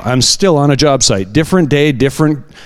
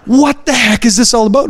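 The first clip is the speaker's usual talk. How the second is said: higher, startled disbelief; amazed outburst; 10 semitones higher than usual. Retold or imitated. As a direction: blurt it out higher in shock. Coworker amazed after healing.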